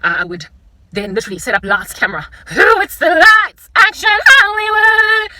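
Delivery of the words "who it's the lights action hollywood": high, loud, slow call to action; mock film director. Playing a Hollywood director calling action, loud. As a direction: bellow it like a director on set.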